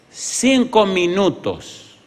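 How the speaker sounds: "Cinco minutos" high and drawn out slowly; slow, high stress on "Cinco minutos"; emphatic repeat. Real, not acted. Repeats key phrase for emphasis.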